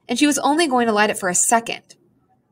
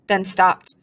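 ordinary delivery

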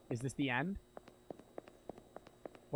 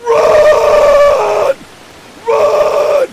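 shrieking in terror, much higher and louder; panicked screaming; 24 dB louder, much slower than usual. Real, not acted. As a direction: scream in real panic at a jump scare. Sudden jump scare in game.

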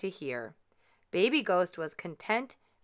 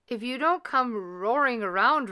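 retort; 5 semitones higher, 4 dB louder than usual. A narrator's reading. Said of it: "retorted Gus".

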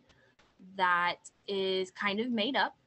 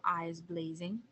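usual speaking voice

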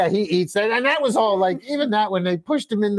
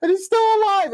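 much higher, pleading mock panic; desperate girlfriend. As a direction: squeal that he's still alive, hammed up. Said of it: "She's like".

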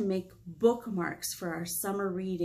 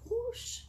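soft high swoosh; a quick magic swoosh; high whoosh; 13 semitones higher, 6 dB quieter than usual. Acted. Librarian voicing the magic change, soft high whoosh. Transformation sound effect.

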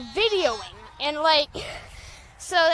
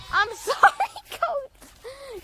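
high giggly sorry; laughing apology; 11 semitones higher, much slower than usual. Real, not acted.

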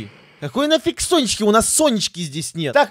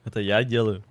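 ordinary talk, nothing marked

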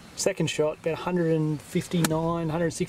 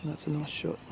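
golf commentator; 5 dB quieter than usual. Acted. S0 commentates the shot.

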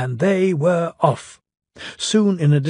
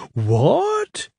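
higher startled question; surprised cry; 7 semitones higher than usual. Acted. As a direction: blurt it out in surprise. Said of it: "cried all the passengers".

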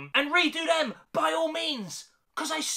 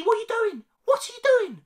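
much higher, louder, pleading at Disney; exasperated plea; 12 semitones higher, 4 dB louder, faster than usual. Real, not acted. Youtuber pleading with Disney, higher and louder. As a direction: throw the question up in disbelief. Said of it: "exasperated repeated plea".

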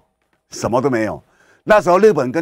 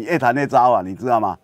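normal talking voice

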